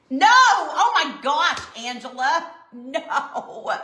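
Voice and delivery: shocked laugh at a wild guess; laughing surprise. Real, not acted. Reacting to a guess in chat.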